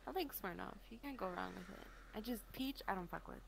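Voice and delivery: normal talking voice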